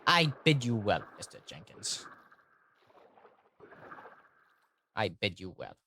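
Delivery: put-on farewell voice, trailing off softly. Bidding Mister Jenkins well, put-on voice. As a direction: let the mock farewell sink away. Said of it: "stop doing that voice".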